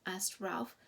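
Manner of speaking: usual speaking voice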